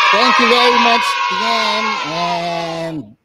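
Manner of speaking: booming, drawn-out thank-you. Real, not acted. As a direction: shout the thanks like closing a show. Host's big sign-off to guests.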